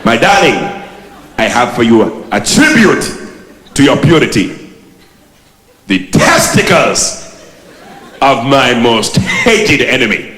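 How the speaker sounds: slow, hammed-up African accent; a light African accent, drawn out slowly. The groom presenting his gift to the bride, mock African accent. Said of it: "so you change the accent".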